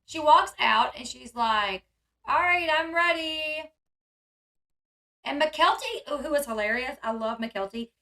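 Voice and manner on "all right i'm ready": higher, drawn-out "ready" call; slow, raised playful quote; mock announcement. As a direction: imitate her announcing it, stretched and sing-song. Acting out her ready announcement.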